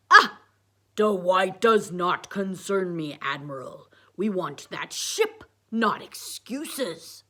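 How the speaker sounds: slow, loud villain commander voice; Darth Paper. A narrator's reading. Harvey's puppet scolding the admiral, slow, loud villain voice. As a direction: boom it slowly like a puppet dark lord. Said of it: "said Harvey, Darth Paper".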